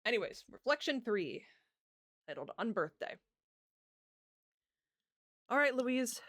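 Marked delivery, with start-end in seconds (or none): none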